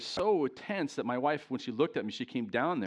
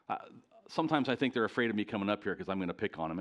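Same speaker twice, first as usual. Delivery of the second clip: usual speaking voice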